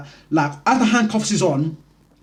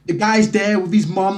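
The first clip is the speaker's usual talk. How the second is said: ordinary talk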